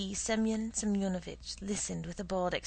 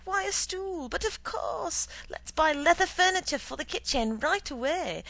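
sarcastic; 10 semitones higher, 6 dB louder than usual. Acted. Husband sarcastic after squeal.

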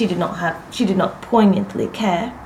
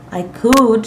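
loud, firm, raised; pitched up, hard and emphatic; harder; 4 semitones higher, 7 dB louder than usual. A narrator's reading. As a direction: assert it with a hard edge. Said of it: "she added harder".

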